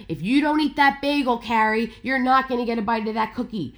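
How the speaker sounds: a hint of a nagging mom laying down the rule; bossy parent. Parent lecturing her child Carrie about a bagel, mock stern. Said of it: "parents, like, would be like".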